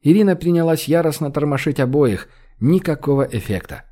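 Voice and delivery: ordinary delivery, nothing marked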